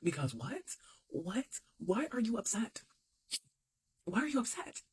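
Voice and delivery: honest bewildered reaction. Retold or imitated. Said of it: "This is my honest reaction".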